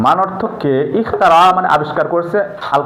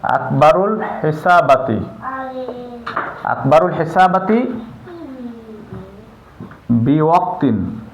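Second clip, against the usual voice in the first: slow dictation; 4 dB quieter, slower than usual. Shown as a demonstration. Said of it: "teacher dictating word by word".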